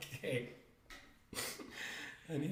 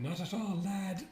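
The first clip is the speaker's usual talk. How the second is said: higher-pitched teasing imitation; mock the co-host's take, pitched up; mimicking the co-host; 6 semitones higher than usual. Co-host teases partner over a misquoted line, mimicking. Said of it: "you said".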